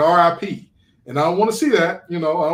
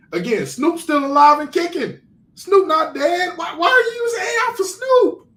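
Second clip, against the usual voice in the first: baffled, squealing higher with every question; exasperated disbelief; 12 semitones higher than usual. Real, not acted. Host protesting AI [name] when [name] is alive, exasperated. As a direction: voice climbing very high in protest.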